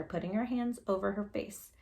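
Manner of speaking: ordinary delivery, nothing marked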